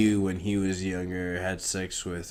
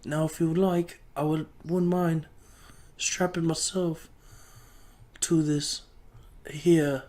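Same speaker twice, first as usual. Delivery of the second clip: much higher, hammed-up, fussy old-world servant; high mock servant voice; 8 semitones higher, 13 dB louder than usual. Servant answering his lord Gryffindor, much higher hammed-up voice. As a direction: play a prim servant bowing to his lord.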